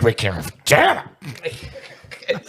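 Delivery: low start breaking into a laugh; mock a wrestling boss, laughing at the end; Vince McMahon. Boss answering news of a union, in mock dismay. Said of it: "And Vince was like".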